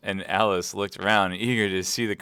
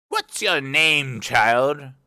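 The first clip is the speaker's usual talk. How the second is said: loud, slow, higher-pitched demand; imperious queen; 7 semitones higher, 8 dB louder, much slower than usual. Acted. Queen questioning Alice, imperious and loud. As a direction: boom it grandly, drawing out the words.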